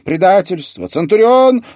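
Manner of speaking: high, very loud prophetic cries; frenzied trance shouting; possessed roar. A narrator's reading. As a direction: roar each word as if possessed. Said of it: "не своим голосом прорычала".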